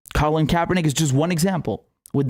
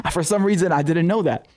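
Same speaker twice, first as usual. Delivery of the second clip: ordinary talk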